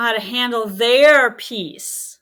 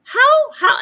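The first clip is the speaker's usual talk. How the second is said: exclaiming; 9 dB louder than usual. Real, not acted. Incredulous repeated question.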